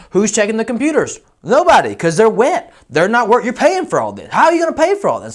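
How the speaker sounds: high, loud demanding client voice; mock angry client. Client demanding answers from the roofer, high and loud. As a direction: grill him in a high put-on voice. Client keeps berating loudly.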